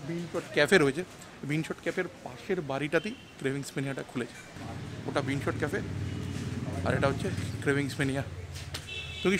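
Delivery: muffled behind surgical mask; speaking masked outdoors; through a face mask. Real, not acted. Seen 2: Selfie speaker wears surgical mask over mouth throughout.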